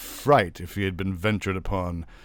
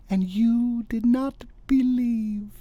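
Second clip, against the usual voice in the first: high, slow triumphant wife; 4 dB louder, slower than usual. A narrator's reading.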